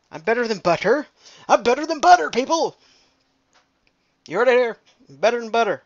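loud, high, triumphant; proud boast. Real, not acted. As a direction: proclaim it to the world.

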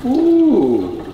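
high drawn-out "ooh"; high whoop. Real, not acted. Cook reacting at the strainer, high whoop. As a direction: let out a high, falling ooh.

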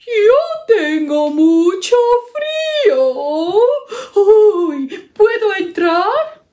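high, slow, pleading shivering bear; a shivering bear begging at the door; cold bear asking. Acted. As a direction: beg to come in from the cold. Bear asks to come in.

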